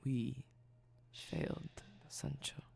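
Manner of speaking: soft, intimate, fading to a whisper; sultry close-mic voice. Acted. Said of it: "my sultry speaking voice".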